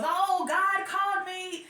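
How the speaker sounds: slow, high, falsely holy voice; mock pious. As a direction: fake humble preacher, drawn out. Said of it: "He goes".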